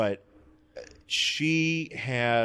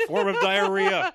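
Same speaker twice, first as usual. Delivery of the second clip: laughing squeal; 4 dB louder than usual. Pretend. Mock spell casting, laughing.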